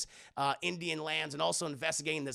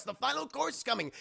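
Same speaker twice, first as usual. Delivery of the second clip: usual speaking voice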